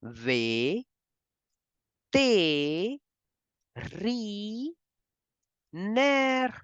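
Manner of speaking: ve, te, ri, ner split with pauses; syllable by syllable. Shown as a demonstration. Teacher splits vétérinaire slowly for learners. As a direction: break the word into slow syllables. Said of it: "We can divide this word".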